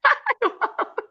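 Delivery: a high burst of laughter; real laugh at herself. Real, not acted. Laughing at her own story.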